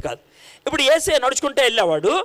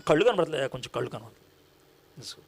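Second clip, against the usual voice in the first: meek plea; 11 semitones lower, 9 dB quieter than usual. Acted. Blind man answers Jesus meekly.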